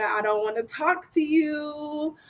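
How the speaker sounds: high, slow, cool put-off; dismissive tone. Retold or imitated. Woman signalling disinterest to a man. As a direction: let the voice say go away. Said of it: "was that like a".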